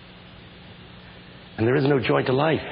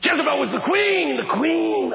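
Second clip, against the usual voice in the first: preaching; 13 semitones higher than usual. Real, not acted. Preacher retelling the Bible, shouted preaching. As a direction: ring out the names like a revival preacher.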